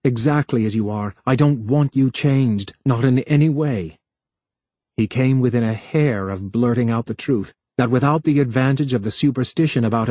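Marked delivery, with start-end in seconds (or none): none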